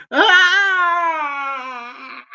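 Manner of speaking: high drawn-out laughing cry. Real, not acted. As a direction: burst into a long, high laugh. Laughs at being called a Zionist.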